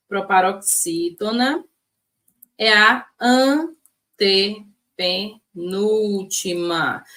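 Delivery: very slow, deliberate definition; slow teaching. Shown as a demonstration. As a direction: stretch it out for the notes. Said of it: "vamos relembrar".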